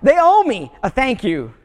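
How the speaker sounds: replaying his own huffy thought, hammed up a bit; indignant inner voice. Preacher retelling his resentful thoughts after returning the dog, mock indignant. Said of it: "And I'm thinking".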